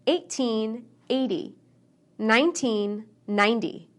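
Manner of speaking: put-on "Thirteen" stressed on "-teen", "thirty" stressed on "thir-"; teen versus ten stress. Shown as a demonstration. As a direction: hit the second syllable of the teens and the first syllable of the tens. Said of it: "We stress teens on the second syllable and tens on the first".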